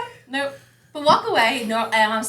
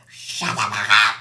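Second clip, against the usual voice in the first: put-on snapping at kid; 12 semitones lower, slower than usual. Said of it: "And I just remember".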